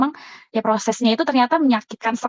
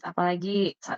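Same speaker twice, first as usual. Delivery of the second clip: ordinary delivery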